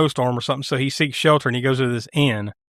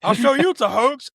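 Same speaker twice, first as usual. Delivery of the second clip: mock menacing killer; 9 semitones higher than usual. Killer voiced beating the cop.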